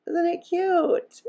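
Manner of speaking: high, slow and sweet; cutesy gush. Real, not acted. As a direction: coo it like admiring something adorable. Gushing over the finished card.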